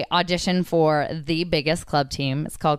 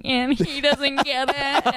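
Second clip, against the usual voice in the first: whiny venting; 7 semitones higher, slower than usual. Retold or imitated. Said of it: "going on about my boyfriend".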